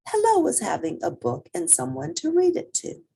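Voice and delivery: ordinary delivery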